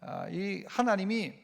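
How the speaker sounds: ordinary talk, nothing marked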